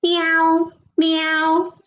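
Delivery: high, drawn-out English "Meow"; cat's "Meow" in English, sustained. Shown as a demonstration. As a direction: say "Meow" like a cat, pitched high and held. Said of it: "Cats say".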